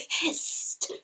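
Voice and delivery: whispered hiss; hissed word. Acted. Teacher hisses the word for the snake to the kids.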